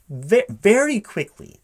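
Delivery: animated high exclamation; emphatic. Real, not acted. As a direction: punch the point home with a squeal. Stressing point about initials.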